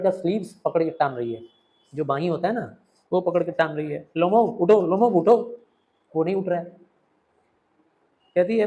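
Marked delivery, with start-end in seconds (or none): 4.2-5.6 higher, louder pleas to wake; urgent calling out. Acted. Natalia shouts at the fainted Lomov to wake. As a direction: shout at him to get up.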